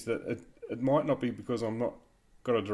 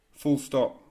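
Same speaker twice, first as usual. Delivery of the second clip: louder, clearly called command; dictation command; 3 semitones higher, 5 dB louder than usual. Shown as a demonstration. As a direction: call the punctuation out.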